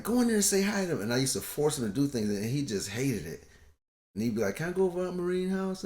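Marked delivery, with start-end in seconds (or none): 0.0-1.0 higher, fast, pushy command; bossy father order. Retold or imitated. Father forcing son to greet. 4.6-5.8 put-on higher, small begging kid voice; pleading son. Voicing son asking to leave.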